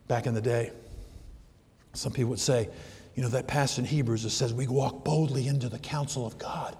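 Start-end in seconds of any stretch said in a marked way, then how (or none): none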